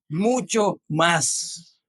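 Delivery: "Mucho más" stretched very slowly, MUCHO weighted; slow each syllable of "mucho más" for weight; preacher's drawn-out stress; drawn-out emphasis. Shown as a demonstration. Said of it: "¿cómo?".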